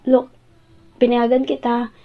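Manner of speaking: usual speaking voice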